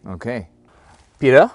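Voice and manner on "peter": loud call across the yard. Real, not acted. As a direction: shout his name to get attention. Calling out loudly.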